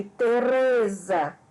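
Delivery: "Teresa" slowed right down, RE stretched; teacher's drawn-out "Teresa", weight on RE; stress on RE. Shown as a demonstration. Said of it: "a sílaba que eu pronuncio com mais intensidade".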